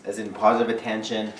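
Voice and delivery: normal talking voice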